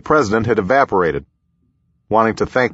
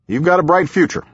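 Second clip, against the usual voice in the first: higher, folksy elder statesman; voiced president; 8 semitones higher than usual. A narrator's reading. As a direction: hand out friendly advice in a raised, drawling lilt. Narrator voices president giving advice.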